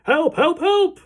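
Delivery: frantic high-pitched yells; screaming for help. A narrator's reading. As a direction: scream for rescue in comic panic. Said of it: "the man was screaming".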